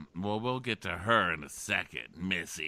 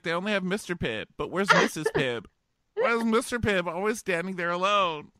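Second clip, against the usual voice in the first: high, louder whining lament; mock whiny lament; 10 semitones higher, 7 dB louder than usual. Bachelor mourning Mister Pibb, high loud mock whine. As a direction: wail it like a sad clown. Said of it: "He's like".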